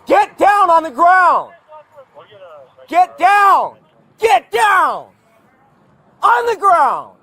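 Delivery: shouting commands. Real, not acted. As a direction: bark the order to get down. Officer orders man to ground.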